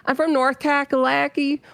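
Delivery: lightly put-on North Carolina twang; Southern accent. Shown as a demonstration. Streamer showing where they are from, North Carolina accent. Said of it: "they talk like this".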